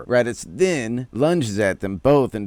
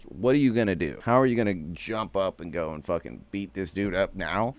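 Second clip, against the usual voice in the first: ordinary talk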